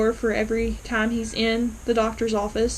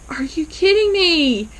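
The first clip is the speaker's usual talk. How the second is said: higher-pitched exasperated outburst, retold; mock-mad disbelief, pitched up; indignant; 6 semitones higher, 4 dB louder than usual. Viewer scolding Elaine for storming in, indignant. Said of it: "I was a little mad".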